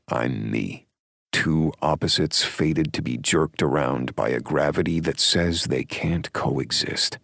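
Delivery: ordinary delivery, nothing marked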